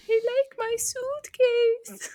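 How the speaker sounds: put-on high, drawn-out little boy voice; imitated little kid. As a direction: slowly squeak it like a small child. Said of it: "and the kid's like".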